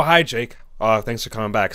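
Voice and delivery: normal talking voice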